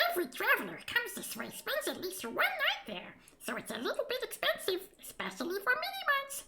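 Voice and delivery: high squeaky sprite voice; a chatty little fairy gossiping; tiny creature. Acted. Creature describes the village.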